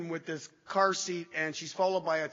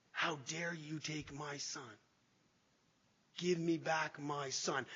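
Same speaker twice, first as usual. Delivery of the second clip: slow, much softer, reflective; hushed repeat; 2 semitones lower, 9 dB quieter, slower than usual. Shown as a demonstration. Retelling his own yelled words to the audience, hushed and slow. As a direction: let the words fall quietly, slowly. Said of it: "You guys hear those words".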